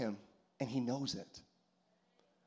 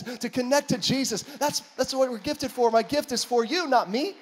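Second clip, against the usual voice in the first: higher, louder, rising sermon; preaching at full force; 6 semitones higher, 5 dB louder than usual. Acted. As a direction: build it up like a preacher. Preaching builds up.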